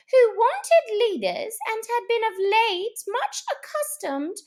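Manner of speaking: high, loud, pompous mouse voice; important Mouse. Acted. Mouse reciting dry history to wet animals, pompous. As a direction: lecture like a tiny pompous professor. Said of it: "with an important air".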